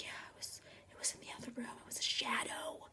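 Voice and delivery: barely audible, breathless whisper; scared whisper. Retold or imitated. Breadstick describing the shadow to friends, scared whisper. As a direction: whisper it in short fearful phrases.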